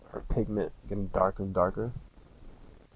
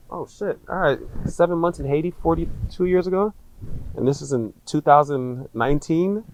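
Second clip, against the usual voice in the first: higher, incredulous rising questions; disbelief; 6 semitones higher, 6 dB louder than usual. Retold or imitated. Doubting his brother's preemie story, high and incredulous. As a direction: sound stunned and doubtful. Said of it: "I was just like".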